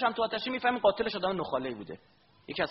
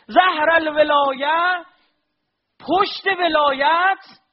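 slow, louder, higher drawn-out cry; slow fervent preaching; 11 semitones higher, 11 dB louder, slower than usual. Acted. Preacher urging the crowd to reflect, slow and impassioned. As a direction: hold each phrase like a lament.

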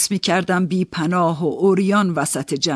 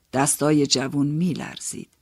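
ordinary talk, nothing marked

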